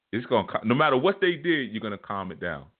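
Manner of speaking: usual speaking voice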